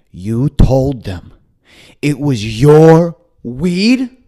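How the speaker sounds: very slow, rising disbelief from father; stunned dad. Retold or imitated. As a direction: drag it out like a dad who can't believe it. Dad stunned by son's confession.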